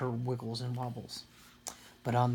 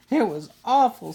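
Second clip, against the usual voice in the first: higher, louder distressed cry; upset; 8 semitones higher, 7 dB louder than usual. A narrator's reading. Sister telling parents her nightmare, upset. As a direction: blurt out how awful it was.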